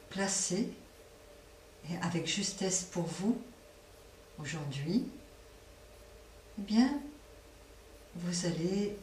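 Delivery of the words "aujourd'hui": usual speaking voice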